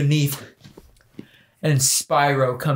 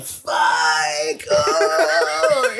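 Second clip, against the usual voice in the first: mock climax cry; much slower than usual. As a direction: play a hammed-up slow-motion groan. Acting out climax in slow motion.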